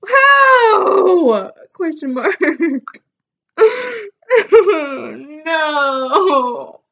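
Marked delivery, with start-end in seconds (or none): 0.1-1.5 high, loud laughing "How?"; shocked laughing shout. Real, not acted. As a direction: blurt it out through laughter.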